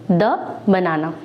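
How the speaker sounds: द बनाना with a short schwa "duh" before बनाना; teacher's model: द बनाना, short "duh"; the before consonant. Shown as a demonstration. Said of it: "इसके पहले बोलेंगे".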